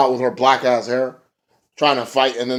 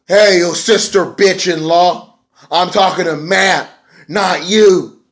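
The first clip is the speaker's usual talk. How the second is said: higher, slow, put-on wrestler sneer; imitating Jeff Hardy; 6 semitones higher, 5 dB louder, slower than usual. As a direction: drag out the insult in a mock promo voice. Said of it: "Jeff Hardy's like".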